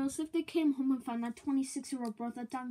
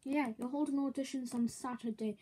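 normal talking voice

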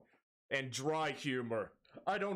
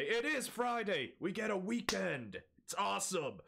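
higher, cheerful Friday cheer; excited about Friday; 7 semitones higher than usual. Real, not acted. Streamer cheers to viewers that the weekend has come. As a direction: celebrate the weekend with a lift.

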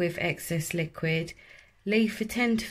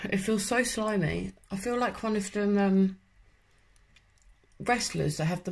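mask slipping into mouth; through a sheet face mask. Real, not acted. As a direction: hands pressing at lips. Seen 1: Wet sheet mask covers face, slips over mouth, hands pressing it.

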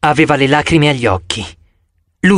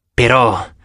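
ordinary delivery

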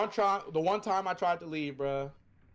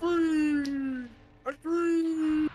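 excited; 10 semitones higher, 5 dB louder, much slower than usual. Real, not acted. Escaping the match.

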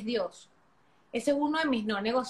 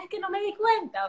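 mock excuse; 7 semitones higher than usual. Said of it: "que tú dices".

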